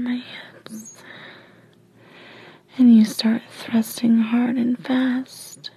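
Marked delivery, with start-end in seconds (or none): none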